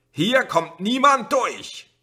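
very high, very loud, slow command; megaphone shout. A narrator's reading. Policeman shouting at the drivers through the megaphone. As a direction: lay down the law, word by word. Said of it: "schrie der Polizist durch das Megafon".

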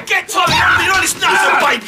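high, loud furious yell; angry shout. Acted. Furious at the landlord throwing them out. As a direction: yell it across the pub.

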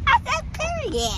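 high-pitched ratchet insistence; ratchet talk. Pretend.

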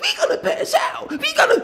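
dad mimicking son's whining about heat; mocking whiny kid. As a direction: mimic the kid's whine.

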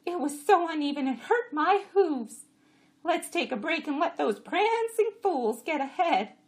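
high, loud donkey voice; complaining jenny. A narrator's reading. Jenny grumbling to the jacks, high and loud.

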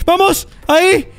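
high, breakneck self-hyping chant; frantic hype. Real, not acted.

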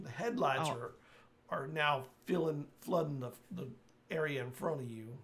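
ordinary delivery

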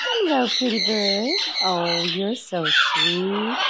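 usual speaking voice

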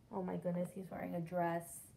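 ordinary delivery

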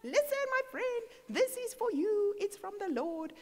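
excited announcement. Retold or imitated. Giving a friend flowers from the Lord, excited and high. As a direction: proclaim it high and emphatic, easing down at the end.

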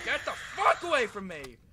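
loud, high panicked shout; terrified yelling. Real, not acted. Gamer yelling at a monster chasing him, terrified shout. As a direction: scream it while fleeing.

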